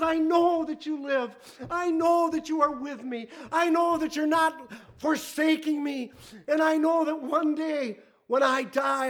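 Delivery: high, loud declaration of faith; crying out. Acted. Preacher voicing Job's certainty to God, crying out in faith. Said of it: "he's crying out".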